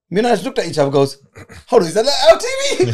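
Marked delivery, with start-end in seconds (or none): 1.7-2.9 high, loud, laughing disbelief; retold astonishment. Man retelling spotting their TV on a video call, astonished. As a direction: re-enact the startled discovery, voice jumping up.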